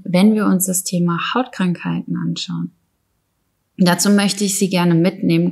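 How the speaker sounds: normal talking voice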